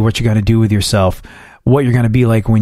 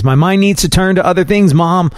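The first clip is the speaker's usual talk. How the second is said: higher, mildly sulky retort to mom; teen talking back; 6 semitones higher than usual. Retold or imitated. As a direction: push back on mom like a bored teenager.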